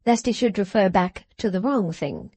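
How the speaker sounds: ordinary delivery, nothing marked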